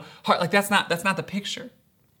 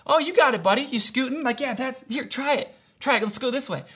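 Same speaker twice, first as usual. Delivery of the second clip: put-on higher, light coaxing of a small child; gentle encouraging; 6 semitones higher than usual. Dad re-enacting helping his toddler on a tricycle, encouraging. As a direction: coax a little one along, raised pitch. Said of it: "And I'm encouraging, I'm urging him".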